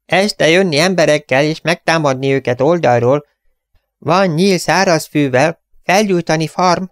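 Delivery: magasabb, tört beszédű indián harcos, lassan; tört mondatokkal tervez támadást. Acted. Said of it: "mondta az indián". (az indián a támadást tervezi, tört magyarsággal)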